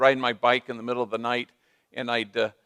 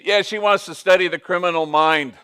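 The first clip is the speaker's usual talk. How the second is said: higher and louder, laughing through it; laughing joke; 5 semitones higher, 7 dB louder than usual. Retold or imitated. Father chiming in about his daughter, laughing. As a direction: chuckle as you tease.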